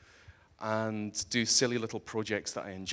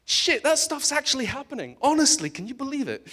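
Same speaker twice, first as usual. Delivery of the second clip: much higher and louder, astonished; amazed; 6 dB louder than usual. Real, not acted. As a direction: blurt it out in disbelief. Amazed reaction to Mars bacteria.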